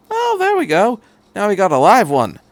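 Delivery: light up with glee; pleased. Acted. The Doctor as his gadget springs to life, pleased. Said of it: "The doctor looked pleased".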